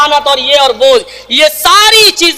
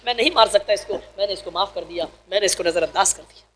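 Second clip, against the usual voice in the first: much softer, tender and hushed; gentle forgiveness; 9 semitones lower, 12 dB quieter, faster than usual. Retold or imitated. Prophet tells Aisha he forgives the maid, soft and tender. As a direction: let the anger go and forgive softly.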